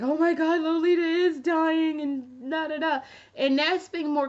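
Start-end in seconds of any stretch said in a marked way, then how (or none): 0.0-3.0 high, loud mock wail of doom; mock panic. Mocking fans who panic over a brand closing, in a high loud wail. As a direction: ham up a dramatic fan's despair. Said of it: "a lot of people were like".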